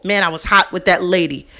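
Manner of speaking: ordinary talk, nothing marked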